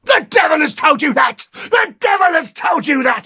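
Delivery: furious scream. Acted. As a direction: shriek it out in a rage, twice. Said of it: "he screamed".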